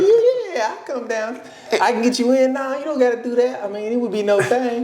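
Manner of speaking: mock eager guy. Mocking Tony Tone as a smitten suitor, high giddy. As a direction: play the overeager suitor. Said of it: "He's like".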